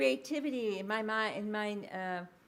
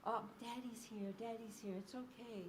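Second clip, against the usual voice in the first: soothing dad; 11 dB quieter than usual. Retold or imitated. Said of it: "it communicates affect".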